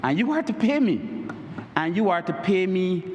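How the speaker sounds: ordinary talk, nothing marked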